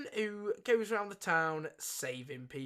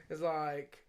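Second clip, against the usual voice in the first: usual speaking voice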